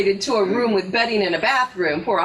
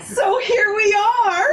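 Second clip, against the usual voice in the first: tearful cry out; 8 semitones higher than usual. Acted. Kelly finishing her confession to Walter, tearful cry. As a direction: burst out, near tears. Kelly's emotional reveal climax.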